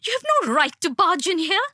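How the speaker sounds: high-pitched indignant protest; mother's high, outraged yell; angry yell. A narrator's reading. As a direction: protest high and forcefully at an intruder. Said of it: "she yelled".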